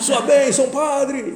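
high, loud holler across a room; imitated distant shout. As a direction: mimic an eager shout from afar. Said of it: "gritou de longe".

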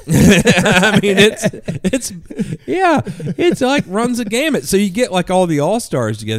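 talking through high laughter; can't stop laughing while explaining. Real, not acted. Laughing to his buddy about the all-stars.

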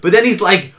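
ordinary talk, nothing marked

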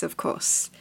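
breathy whispered aside. Real, not acted. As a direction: whisper it under your breath. Same aside repeated, whispered.

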